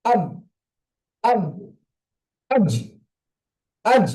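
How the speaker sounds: আব and আজ with a bounce on the final consonant; qalqala demo. Shown as a demonstration. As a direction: release the final stop with a small echo. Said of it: "ধাক্কা লেগে উচ্চারণ".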